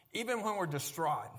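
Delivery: ordinary talk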